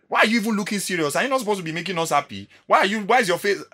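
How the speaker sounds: high, loud indignant seller imitation; mock scolding seller. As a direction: scold him for not smiling, shrill and loud. Seller scolding his serious face.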